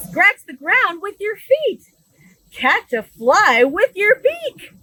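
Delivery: bright, lively storybook read-aloud; animated picture-book reading; reading to children. Acted. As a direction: read it big for a room of little kids.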